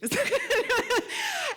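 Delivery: a sudden high burst of laughter; high amused laugh. Real, not acted. Storyteller laughing at her own car troubles, high laugh.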